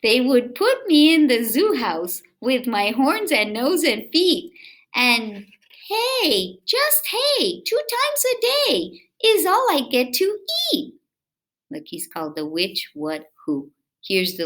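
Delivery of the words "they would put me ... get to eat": lively, sing-song read-aloud voice; reading to children. A narrator's reading. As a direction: read it to little kids with bounce. Reading picture book aloud.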